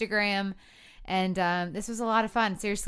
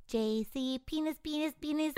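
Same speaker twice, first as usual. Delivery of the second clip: very small voice; 6 semitones higher, 6 dB quieter than usual. Retold or imitated. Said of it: "he's like".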